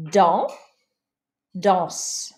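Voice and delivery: Dans with no S, then danse ending in S; final S contrast. Shown as a demonstration. Teacher showing how E makes S heard. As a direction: say the pair, adding S on danse. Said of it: "The E makes the S pronounceable".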